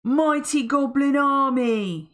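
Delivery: slow, loud and high summons; rally the troops; rallying cry. Acted. Mister Gum summoning his goblins, slow loud cry. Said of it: "he cried".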